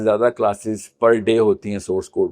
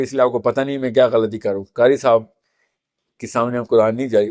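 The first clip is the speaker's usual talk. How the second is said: ordinary talk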